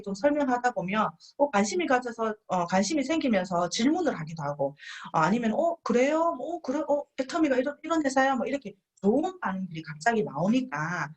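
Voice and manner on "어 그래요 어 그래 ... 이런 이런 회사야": higher, curious wow; mock surprise. Guest hearing about the company, surprised interest. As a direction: act out a guest perking up at the news.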